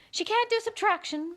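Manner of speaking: high, tutting verdict. A narrator's reading. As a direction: cluck it high and prim.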